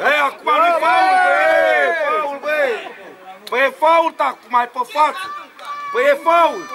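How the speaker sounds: protesting shout. Real, not acted. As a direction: call out the foul to the referee. Protesting a foul from sideline. (protest strigat de pe margine)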